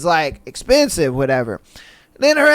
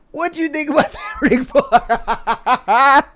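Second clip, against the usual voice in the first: high-pitched question dissolving into laughter; laughing question; 5 semitones higher, slower than usual. Real, not acted. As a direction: crack up as you put it to your co-host. Cracking up after the story.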